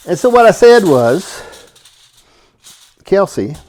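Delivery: normal talking voice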